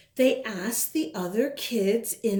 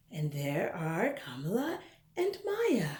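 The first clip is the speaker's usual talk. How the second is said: whisper; 4 semitones lower, 6 dB quieter than usual. Real, not acted. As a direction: lean in and whisper the names. Whispered aside to children.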